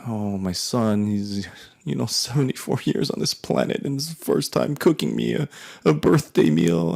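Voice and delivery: a mother's guilt trip rising in pitch; mom's rant. Retold or imitated. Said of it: "she goes on this rant of".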